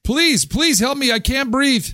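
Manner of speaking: high, fast, pleading cry for help; mock screaming for help. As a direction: plead with the other housekeepers, hammed up. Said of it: "you can hear them screaming".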